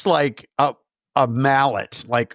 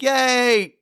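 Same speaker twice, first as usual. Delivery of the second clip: put-on child's cheer; 9 semitones higher than usual. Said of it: "Rowan is like".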